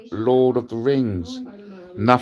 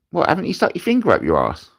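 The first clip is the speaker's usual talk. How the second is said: fast, higher mock-incredulous mate; mate's voice; 9 semitones higher, faster than usual. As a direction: play the best mate who can't believe it. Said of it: "he goes".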